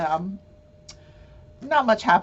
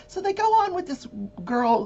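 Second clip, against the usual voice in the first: normal talking voice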